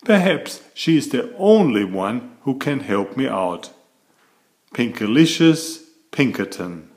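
usual speaking voice

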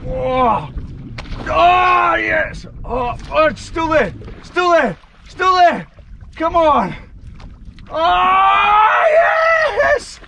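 high, loud thrilled yelling; excited shout. Real, not acted. Fish strikes, shouting with joy.

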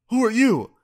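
high, loud suspicious bark; sharp demand. Retold or imitated. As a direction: demand it from across the yard. Stranger demands who the narrator is.

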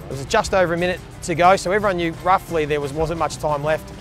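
normal talking voice

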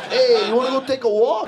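a hint of a menacing mob boss invite; lightly voiced wiseguy, laughing; mock mafia don asking for a walk; old Italian mobster. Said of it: "some old Italian guy".

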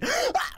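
sudden high gasp; short high yelp as if about to scream; mock scream intake. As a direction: fake start of a shriek. Pretends to start screaming again.

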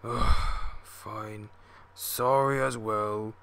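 grudging, grumpy apology after a groan; slow, begrudging steamroller voice; reluctant. A narrator's reading. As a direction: give in with bad grace. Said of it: "said a reluctant George".

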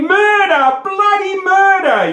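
high, loud hammed-up yell of murder. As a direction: scream it for the whole street to hear. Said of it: "yelled the Man from Ironbark".

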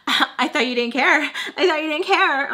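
high, loud mocking imitation; laughing taunt. Friend laughs at her about caring, high mocking taunt. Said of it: "because she starts laughing".

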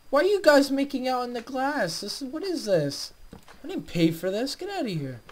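high, loud indignant ranting; frantic fed-up complaining at the villagers; exasperated. Real, not acted. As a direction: squeal out your outrage at them. Villagers stuck in glass.

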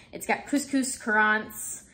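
usual speaking voice

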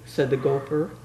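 plain reading voice

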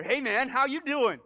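high, loud, slow buddy greeting; casual God greeting. Acted. God appearing and shoving your shoulder, loud casual greeting. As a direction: clap a friend on the shoulder. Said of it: "appeared to you and was like".

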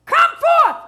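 high, loud summons; full-voiced high command; commanding shout. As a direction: bellow an order to everyone in the tombs. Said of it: "if Jesus had just said".

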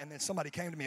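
usual speaking voice

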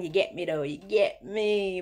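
drawled South London "you get me"; South London slang. Shown as a demonstration. Showing off her South London slang, drawled. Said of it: "I can switch to the slang, like the South London slang".

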